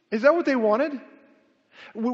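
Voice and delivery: usual speaking voice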